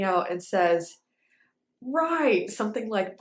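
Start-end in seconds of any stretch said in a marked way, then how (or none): 1.8-2.5 put-on a light British accent on "Right"; clipped British choreographer's "Right". Quoting the British choreographer's verdict in a British accent. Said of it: "in his British accent".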